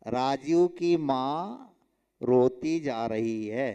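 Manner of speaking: राजू की माँ drawn out, pause, then slow रोती जा रही है; slow, separated माँ and रोती; misleading word breaks. Shown as a demonstration. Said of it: "अब लिखने का ढंग क्या होगा".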